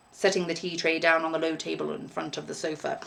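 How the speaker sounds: usual speaking voice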